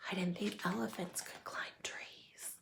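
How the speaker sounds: whispered aside. Acted. Narrator whispering an aside to the listener, whispered. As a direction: breathe it as a secret.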